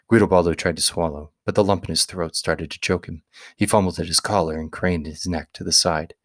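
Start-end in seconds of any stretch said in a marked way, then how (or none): none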